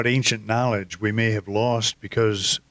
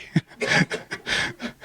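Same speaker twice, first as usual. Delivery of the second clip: laugh. Real, not acted. Laughing at friend's croak joke.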